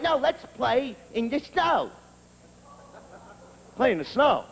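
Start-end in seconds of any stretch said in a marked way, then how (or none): 0.0-1.9 lightly voiced wife, slowed and pleading; wife's voice. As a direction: coo it like a wife asking to play. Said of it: "she's going".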